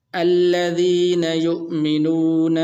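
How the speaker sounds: very slow melodic chant; Quran recitation. Shown as a demonstration. Teacher reciting the verse to students in slow melodic tajweed. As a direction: sing out the verse slowly, stretching the vowels.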